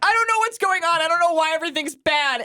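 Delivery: high, lost mock-teen whine; lost teenager. Mocking her sixteen-year-old self, high bewildered whine. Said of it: "I was terrible, and I'm like".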